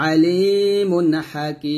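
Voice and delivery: Hakim drawn out very slowly at the stop; slow tajweed recitation; Quran recitation. Shown as a demonstration. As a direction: chant আলিমুন Hakim, holding the end. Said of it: "যদি ওয়াকফ ধরেন তাহলে হবে".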